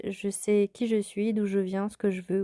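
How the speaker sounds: ordinary talk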